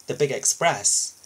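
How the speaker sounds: usual speaking voice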